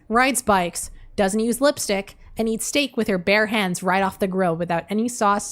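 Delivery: usual speaking voice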